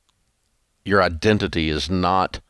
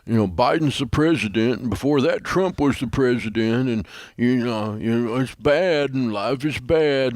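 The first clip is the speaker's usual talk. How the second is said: higher, drawn-out mock whine; exaggerated woe-is-me complaining; pickle-faced moaning; 4 semitones higher, slower than usual. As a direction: moan slowly like a hammed-up sad sack. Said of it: "sit around going".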